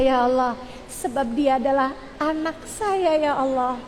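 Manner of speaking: pleading supplication with drawn-out endings; demonstrating an earnest, lingering prayer; model prayer. Shown as a demonstration. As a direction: implore God for your child. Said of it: "kamu doanya kayak gini".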